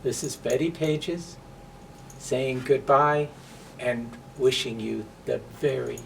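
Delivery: ordinary delivery, nothing marked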